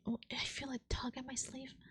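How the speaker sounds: breathy hushed whisper; scared whisper to the audience; frightened whisper. Real, not acted. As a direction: whisper it as if something's behind you. Unvoiced whisper in fear.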